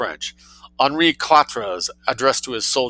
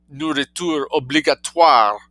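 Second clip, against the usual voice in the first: French; 4 semitones higher than usual. Shown as a demonstration. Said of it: "if I had said".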